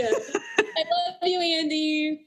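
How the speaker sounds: hammed-up tearful gushing, high and slow; mock sobbing. To Andy for his email, a mock sob.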